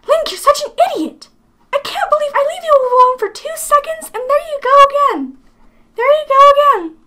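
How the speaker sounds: exasperated high-pitched scolding; high ranting character voice. Acted. Companion fed up with Link leaving trouble behind, squeaky scolding. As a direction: squeal in disbelief, high and loud. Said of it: "Especially in this voice".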